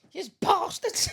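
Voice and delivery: high shout cracking into laughter; retold indignant cry through giggles; laughing mock outrage. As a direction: yell the accusation while laughing.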